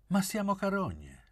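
woman's sudden high retort; sudden outburst. A narrator's reading. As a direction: burst out with it, pitched well up. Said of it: "scattò Gabriella".

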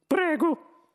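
high, loud mouse voice; Benji. A narrator's reading. Mouse resumes the meeting, high squeaky voice. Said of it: "disse Benji".

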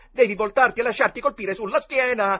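loud, high, emphatic recital; insistent quoting. A narrator's reading. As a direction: cite the rule book at full voice. Boy quotes book, insisting. (citazione insistente, a gran voce)

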